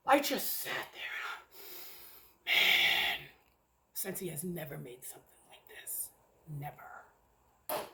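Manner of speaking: hushed, breathless whisper of awe; awed whisper. Real, not acted. Reviewer stunned by a wax melt, awed whisper. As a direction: barely voice it, stunned by the scent. Overwhelmed describing the Pink Strawberries scent.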